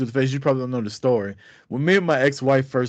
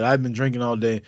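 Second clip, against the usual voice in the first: ordinary talk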